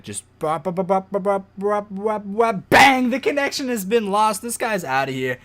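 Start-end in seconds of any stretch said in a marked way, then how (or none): 0.0-2.7 dramatic bop noises. Pretend. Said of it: "make it dramatic". 2.7-3.1 loud mock explosion bang; dramatic bang. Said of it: "make it dramatic".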